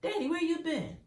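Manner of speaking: put-on high, bright little-kid voice; small child asking. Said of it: "one of y'all was like".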